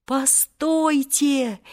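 high, drawn-out call to stop; mild shouted plea. A narrator's reading. Volka halting Omar Yusuf to save Seryozha, drawn-out mild cry. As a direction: call out slowly without real volume. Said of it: "закричал тогда изо всех сил Волька".

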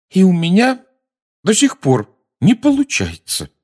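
put-on high, cross girl voice; sulky and annoyed. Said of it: "сердито добавила она".

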